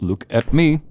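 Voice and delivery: loud sharp order; commanding shout. Acted. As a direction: bark it to force attention. Villain demands attention sharply.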